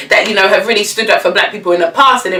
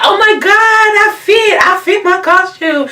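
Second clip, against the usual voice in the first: squealed way up high; giddy excitement; 11 semitones higher than usual. Retold or imitated. [name] fitting her old costume, imitated squealing delight. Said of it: "and she was like".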